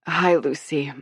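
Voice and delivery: rough, gritty sick voice. A narrator's reading. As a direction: rasp it like a sick man. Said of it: "in a gritty voice".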